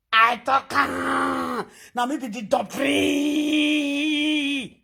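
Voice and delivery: slow drawn-out mock boast. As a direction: stretch the words out, mocking a braggart. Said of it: "Na what they say".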